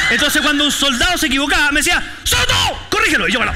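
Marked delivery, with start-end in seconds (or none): 2.2-3.3 high barked order; sergeant's shout. Retold or imitated. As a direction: snap the command at the recruit.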